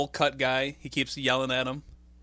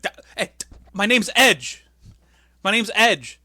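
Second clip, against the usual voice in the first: louder, higher "my name's Edge"; mock indignant; 8 semitones higher, 10 dB louder than usual. Edge telling the desk clerk who he is, mock loud. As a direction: insist on your name. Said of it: "He's like".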